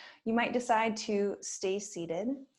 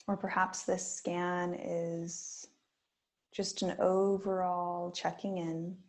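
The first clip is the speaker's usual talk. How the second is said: guided meditation. Acted. Yoga teacher guiding students through a body scan, gently. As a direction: ease listeners into stillness with long pauses.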